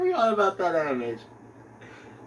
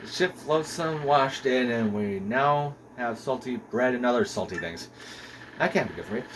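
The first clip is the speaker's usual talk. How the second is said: lower, solemn castaway log reading; journal narration; 4 semitones lower, 5 dB quieter than usual. A narrator's reading. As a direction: read the shipwreck diary with gravity. Reads castaway journal entry.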